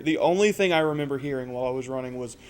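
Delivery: ordinary delivery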